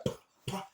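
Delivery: unvoiced popping fireworks imitation; fireworks noise. Mimicking fireworks going off during the deliverance, breathy pops. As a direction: pop it out breathily like distant fireworks. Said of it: "you heard the fireworks go".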